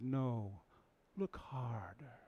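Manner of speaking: slow, urgent whisper. Retold or imitated. Monkey urging Simba to look harder, whispering. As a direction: press him gently to look again.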